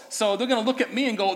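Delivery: normal talking voice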